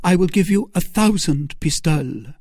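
high-pitched Milady voice; bargaining Milady. Acted. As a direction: make the offer in a high voice. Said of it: "said Milady".

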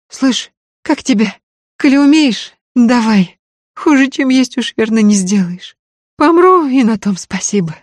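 high, pained voice through tears; girl with toothache. Acted. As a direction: whimper it, aching jaw, near crying. Toothache girl begs for help.

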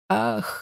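a sudden high breathy "Ах"; sharp high gasp of climax; cry of pleasure. A narrator's reading. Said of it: "закричала".

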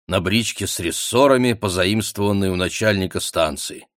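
plain reading voice

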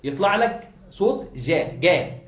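جا with a slow, hard g; the hard g in جا, said slowly and firmly; hard g sound. Shown as a demonstration. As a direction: blend kaf and jim into جا. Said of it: "إذا خلطت أنت صوت الكاف بصوت الجيم".

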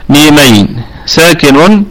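ordinary talk

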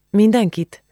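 "Mindenkit?" asked high and doubtful; surprised echo. A narrator's reading. Julcsi questions Ági about Igor's greeting, surprised.

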